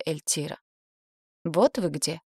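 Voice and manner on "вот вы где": plain reading voice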